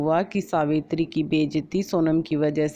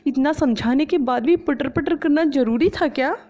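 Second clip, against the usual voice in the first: higher-pitched exasperated saas voice; scolding mother-in-law; 9 semitones higher than usual. Acted. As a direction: scold the chattering bahu. (झुंझलाई हुई सास की आवाज़)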